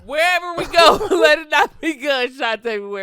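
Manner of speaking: loud joking plea, laughing through it; half-laughing loud wish before a trip; mock prayer. Podcast friend jokes about travel, loud laughing plea. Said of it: "I'll be like".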